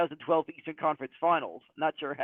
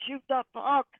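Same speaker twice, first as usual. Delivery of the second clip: mock drunken yell; 8 semitones higher than usual. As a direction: imitate yelling at the game, volume held back. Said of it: "probably drunkenly yelling out".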